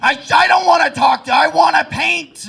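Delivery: high-pitched raging shout; frantic, deranged outburst; Vincent van Gogh. Acted. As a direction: yell like an unhinged painter. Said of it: "the raging schizophrenia".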